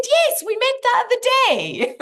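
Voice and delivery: very high, loud gleeful reply; gleeful high punchline to the grumpy professor. Retold or imitated. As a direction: toss it up high like a triumphant joke. Punchline retold with glee.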